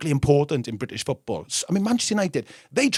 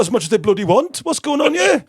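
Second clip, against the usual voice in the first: put-on English accent, blustering; 9 semitones higher, 4 dB louder than usual. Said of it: "Let me have a go at an English one".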